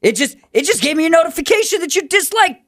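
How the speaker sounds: high-pitched, alarmed protest. Acted. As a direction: protest the notification in a high, agitated voice. Upset by unlike during intervention.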